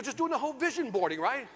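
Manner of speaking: ordinary talk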